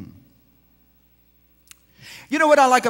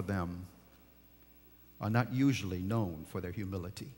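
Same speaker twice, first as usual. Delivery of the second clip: low, soft caution from the pulpit; hushed preacher counsel; quiet sermon warning; 11 semitones lower, 9 dB quieter than usual. Acted. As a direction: warn them gently and low.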